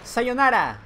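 loud taunting goodbye; mock farewell. Gamer taunting a dying zombie, loud gloat. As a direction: gloat as the enemy dies. Finishing off enemy.

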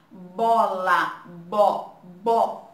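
"Bola, bo, bo" broken into syllables, loud "bo" repeated; sounding out syllables. Shown as a demonstration. Teacher showing pupils the sound bo, loudly. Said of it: "Olha aí o som da palavrinha".

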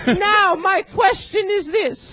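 high, slow, shouted British announcement; pompous stage-British proclamation; put-on British accent. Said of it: "He sounds British and then German".

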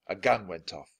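ordinary delivery, nothing marked

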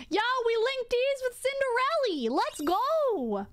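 high, excited shout-out; hyped. Real, not acted. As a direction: pump it up, playful. Hyped playful aside.

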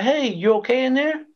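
high-pitched retold check-in; calling through door. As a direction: call in to someone behind a closed door.

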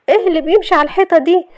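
high, quick decoy question; flustered Shahd. Acted. Shahd distracting Youssef as he pulls closer, flustered.

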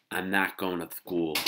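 put-on loud little-girl refusal; defiant child. A narrator's reading. Little girl tells mother about school, defiant. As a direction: announce it stubbornly as a small child.

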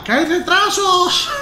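high, slow wailing cry about the delays; imitating the Renfe worker. Imitating the Renfe worker wailing about delays, high and slow. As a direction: ham up a panicked worker. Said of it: "Porque decía".

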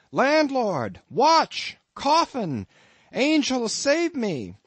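high, loud cry for help; panicked shout. A narrator's reading. Ishmael calling the landlord while threatened, panicked shout. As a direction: scream for the landlord in terror.

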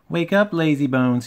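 high, loud cheeky wake-up call; whistling engine. A narrator's reading. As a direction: rouse a sleeper with a whistle-like call. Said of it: "he whistled".

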